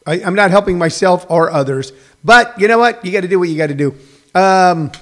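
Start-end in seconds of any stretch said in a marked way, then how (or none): none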